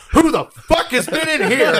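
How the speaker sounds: high, loud outraged disbelief; shocked gynecologist. Pretend. Gyno with a little camera finds cave drawings, loud mock shock. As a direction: blurt it out in stunned, mock-scandalized alarm.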